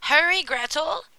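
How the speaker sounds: loud, high impatient witch; impatient old witch. A narrator's reading. Witch orders Gretel to hurry, loud and impatient. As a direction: order her about, out of patience. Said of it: "the old witch lost patience".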